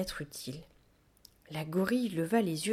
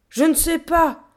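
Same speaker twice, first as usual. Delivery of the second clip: high, loud yell back; hurlement; 10 semitones higher, 11 dB louder than usual. A narrator's reading. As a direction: yell it straight back at him. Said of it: "hurla-t-elle en retour". (hurler en retour, voix aiguë)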